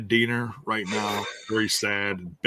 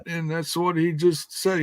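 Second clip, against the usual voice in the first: usual speaking voice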